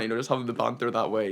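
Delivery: usual speaking voice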